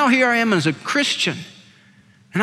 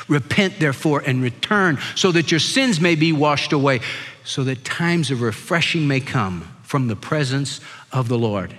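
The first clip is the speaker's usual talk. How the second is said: solemn scripture reading; 6 semitones lower than usual. Acted. Reads the verse again.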